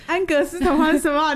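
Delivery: high, loud teasing disbelief; incredulous query. Real, not acted. Co-host catching the misnamed fairy tales, loudly incredulous.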